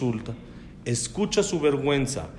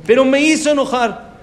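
mock angry complaint; 10 semitones higher, 8 dB louder than usual.

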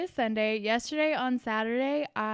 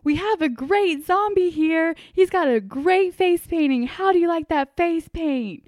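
bright, louder, higher reporter voice, slow; news reporter voice; 5 semitones higher, 9 dB louder than usual. Retold or imitated. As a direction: ham up an upbeat TV reporter interviewing a kid. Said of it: "the news reporter was like".

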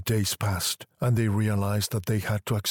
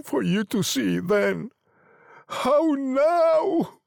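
slow, high, halting anguish; shaking voice; slower than usual. A narrator's reading. Old man telling of his son's body, voice shaking. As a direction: falter through it slowly, pitched high. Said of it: "and his voice shook".

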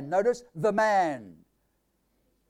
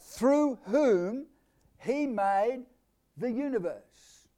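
"Through" lifted, then "he" lifted, "the universe" drawn out slowly; word by word emphasis on "Through whom he made the universe"; stressed phrase; 3 semitones higher, much slower than usual. Shown as a demonstration. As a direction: build "Through whom" and "he made" as rising pushes, stretch "the universe". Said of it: "I'm going to emphasize certain things".

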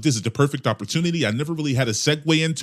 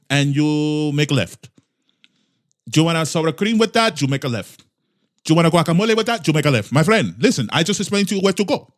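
loud, hammed-up Spanish-accented English; exaggerated mock Mexican accent; 4 semitones higher, 4 dB louder than usual. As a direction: play a farmer giving directions in a thick Spanish accent. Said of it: "in a Spanish accent".

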